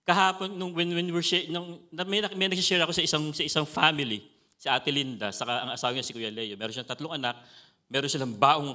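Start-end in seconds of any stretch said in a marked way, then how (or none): none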